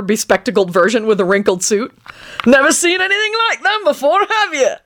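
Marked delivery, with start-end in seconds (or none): 2.5-4.7 put-on high, eager old codger; excited old man warble. A narrator's reading. Old man boasting of his minions, high excited warble. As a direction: bubble over like a proud old crank. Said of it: "warbled excitedly".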